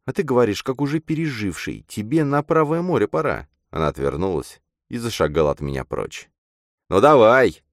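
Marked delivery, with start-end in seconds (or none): 0.1-3.5 put-on reproachful girl character. A narrator's reading. Girl tells her friend he has changed, reproachful. 6.9-7.6 loud, higher cry; shouted challenge. A narrator's reading. Man dares the girl to go ahead, shouting. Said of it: "крикнул я".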